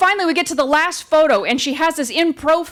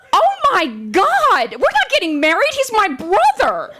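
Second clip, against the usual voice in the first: shocked protest; 7 semitones higher than usual. Retold or imitated. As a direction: protest in hammed-up, high-pitched disbelief. Retold shocked protest to the photographer.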